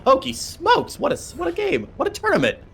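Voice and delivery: high, excited caster exclaiming; thrilled disbelief at the upset; caster's excited outburst; amazed. Real, not acted.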